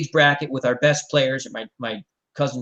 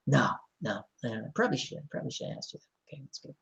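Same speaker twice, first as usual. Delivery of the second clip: trailing off; 3 semitones lower, 12 dB quieter, faster than usual. Retold or imitated. Coach realizing his mistake.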